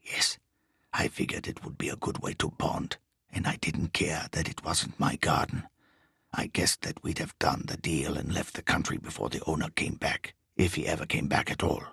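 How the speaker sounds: low, quiet, bass-heavy foreign thief; deep foreign voice. A narrator's reading. Riso answers his partner's questions in a low bass voice.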